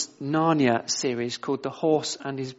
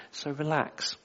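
gentle reassurance; 3 semitones lower, 5 dB quieter than usual. Real, not acted. Preacher reassuring congregation.

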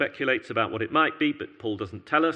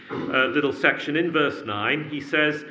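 normal talking voice

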